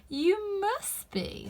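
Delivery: slow, drawn-out knowing cat; sly cat taking its time; lazy Cheshire Cat. A narrator's reading. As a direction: savour each word like a grinning cat.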